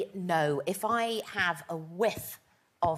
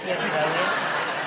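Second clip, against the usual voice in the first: put-on slow, stretched Scottish accent with a laugh; drawn-out Scottish accent; 8 dB louder, much slower than usual. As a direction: drawl "You're brilliant" in a hammed-up Scottish accent. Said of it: "I'll give it a go".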